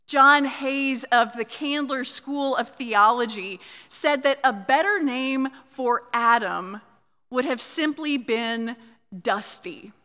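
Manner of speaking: ordinary talk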